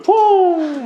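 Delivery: high drawn-out owl call; owl hoot. Retold or imitated. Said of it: "부엉이가 막 울어요". (높은 부엉이 울음 흉내)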